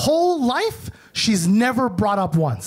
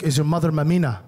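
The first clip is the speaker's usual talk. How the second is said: lower, heavier uncle voice; imitating uncle; 8 semitones lower, faster than usual. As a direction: uncle cornering his nephew at a reunion, low and heavy. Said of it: "He's like".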